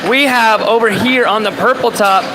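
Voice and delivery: normal talking voice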